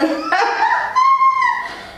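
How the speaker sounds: a squealing burst of laughter, very high; helpless high-pitched cackle; high shrieking laugh. Real, not acted. Cracking up at own story.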